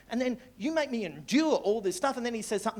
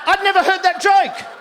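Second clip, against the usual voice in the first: loud and high, laughing through it; laughing shout; 11 semitones higher, 11 dB louder than usual. Retold or imitated. Comic reliving his joy at the kid's punchline, laughing shout. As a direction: bellow it out in delight. First take versus quieter telling.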